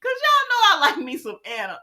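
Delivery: high, teary-voiced confession; nearly crying over a favourite; tearful. Real, not acted. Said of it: "crying heard".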